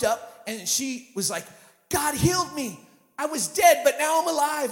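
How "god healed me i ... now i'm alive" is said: loud, high cry of joy; joyful shout. Retold or imitated. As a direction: shout it like you just came back to life. Healed woman shouts joyfully.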